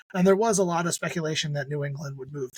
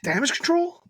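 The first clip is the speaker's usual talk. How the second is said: incredulous question; 11 semitones higher than usual. Real, not acted. Incredulous guess.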